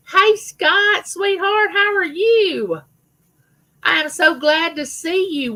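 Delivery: high, slow sing-song hello; delighted greeting. Real, not acted. Welcoming a viewer who just joined, high sing-song greeting.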